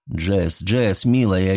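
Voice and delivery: plain reading voice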